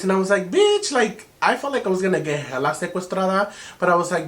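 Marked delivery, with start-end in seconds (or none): 0.5-0.9 high, loud mock-shocked cry; shocked exclamation. Woman reacting to the hookup's elaborate instructions, shocked.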